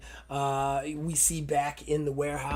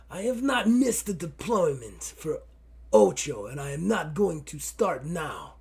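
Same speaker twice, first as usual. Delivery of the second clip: put-on Russian accent; 3 semitones higher, 3 dB louder than usual. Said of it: "I am Russian and arrogant".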